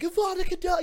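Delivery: high-pitched imitation of a pursuer's cry; mock spell-casting cry. Counselor chasing a boy through the woods, mock spell-casting cry. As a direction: cry it out high like a hammed-up wizard.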